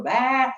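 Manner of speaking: high billy goat "Bah" again; kids' game goat cry; goat bleat. Retold or imitated. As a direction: bleat like a goat before butting heads. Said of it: "and we'd go".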